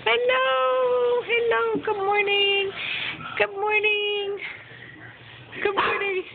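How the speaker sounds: high cooing hellos. Real, not acted. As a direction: sing a gentle good morning to the babies. Greeting the babies in the morning.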